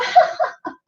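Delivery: very high, breathy ha ha ha; staged laughing ha ha ha; fake laugh. Shown as a demonstration. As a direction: laugh on purpose for the photo. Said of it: "and then you go".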